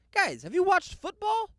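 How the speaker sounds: fed-up shout at the TV; exasperated outburst. Real, not acted. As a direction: yell in disbelief at the pundits. Exasperated at Bills being favorites.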